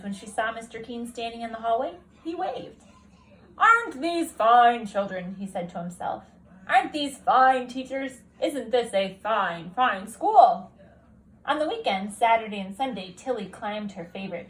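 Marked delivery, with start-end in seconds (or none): 3.6-5.4 slow, loud, beaming headmaster; a delighted school principal, slow and loud; proud principal. Acted. Principal character voice. 6.7-10.7 slow, loud, beaming headmaster; a delighted school principal, slow and loud; proud principal. Acted. Principal character voice.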